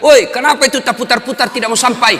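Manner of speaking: high, loud heckling yell; shouted complaint. Retold or imitated. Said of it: "dia teriak".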